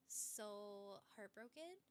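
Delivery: usual speaking voice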